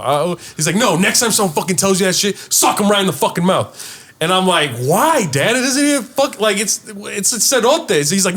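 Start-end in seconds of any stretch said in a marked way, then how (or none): 0.8-3.7 raised, emphatic mock scolding; imitated angry dad. Dad telling his child to fight back, indignant. Retelling dad's angry instruction. 4.8-8.0 high-pitched retold protest; bewildered protest. Child questioning dad about a nickname, bewildered.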